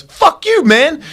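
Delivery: high, sharp "fuck you"; angry retort. Retold or imitated. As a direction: fire back at the trainer. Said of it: "the guy goes".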